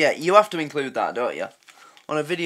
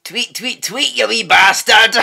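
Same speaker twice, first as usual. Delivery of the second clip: mock Scottish accent, shouted at the end; a Scottish bird yelling in broad Scottish; 6 semitones higher, 10 dB louder than usual. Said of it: "a bird have a Scottish accent".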